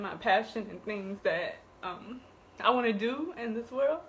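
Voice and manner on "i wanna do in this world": ordinary delivery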